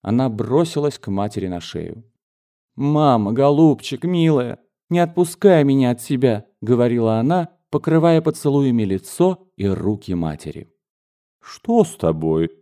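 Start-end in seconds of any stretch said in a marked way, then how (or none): 2.8-6.5 put-on higher, a girl begging mama not to let her go; cling to her mother and plead; pleading daughter. A young daughter hugging her mother, begging to stay.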